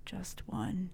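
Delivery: small hushed whisper; sheepish whispered admission. Retold or imitated. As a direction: whisper it, embarrassed. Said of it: "get really quiet".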